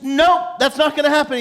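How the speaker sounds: high, indignant mock refusal; angry refusal. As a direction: play an offended man refusing outright. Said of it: "He's, like, angry".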